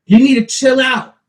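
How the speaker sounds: hammed-up shouting at his son; imitating dad yelling. Dad yelling at his son while shoveling snow, mock shout. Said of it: "'cause then you're screaming at me".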